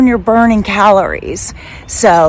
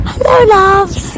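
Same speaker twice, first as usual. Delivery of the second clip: slow, much higher, louder "Hello, loves"; sing-song greeting; 13 semitones higher, 6 dB louder, slower than usual. Acted. Vlogger greeting her viewers in a drawn-out sing-song. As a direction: stretch it out like a cheery hello.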